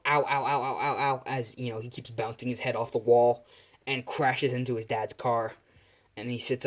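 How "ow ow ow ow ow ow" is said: string of pretend ows; lightly acted yelps of pain; mock pain. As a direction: play-act repeated bumps to the head. Hero bouncing head off wall.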